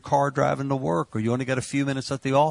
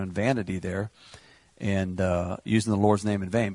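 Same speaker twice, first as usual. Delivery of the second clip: ordinary delivery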